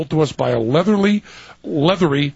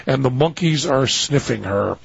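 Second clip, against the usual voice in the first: ordinary talk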